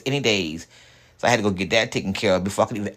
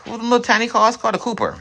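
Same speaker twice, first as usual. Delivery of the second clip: mock tiny car; 13 semitones higher, 3 dB louder, faster than usual. Real, not acted.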